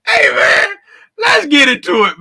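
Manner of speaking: loud, laughing kickoff; laughing restart. Real, not acted. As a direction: laugh your way into it. Still laughing, restarting show.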